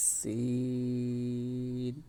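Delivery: সিন drawn out very slowly and clearly; Arabic letter demo. Shown as a demonstration. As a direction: stretch সিন out long like a Quran teacher. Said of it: "তারপর". (ধীরে টেনে সিন উচ্চারণ)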